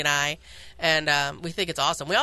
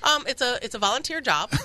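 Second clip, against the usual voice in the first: usual speaking voice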